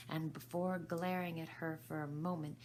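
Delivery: usual speaking voice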